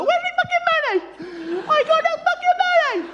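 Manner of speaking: very high, squealed complaint; indignant claimant. Acted. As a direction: demand your money in a high-pitched whine. Claimant demanding money at dole office.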